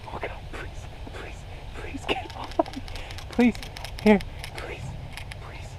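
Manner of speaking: breathless, frightened whisper pleading; terrified whispering. Real, not acted. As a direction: whisper the begging in real fear. Monkeys climbing on her.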